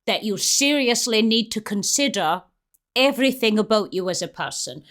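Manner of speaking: ordinary delivery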